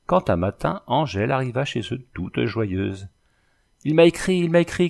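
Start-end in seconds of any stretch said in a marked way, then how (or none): 3.8-4.9 high, loud joyful outburst; girl bursting in with joyful news; joyful cry. Acted. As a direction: burst in crying out the good news. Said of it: "cria-t-elle en rentrant".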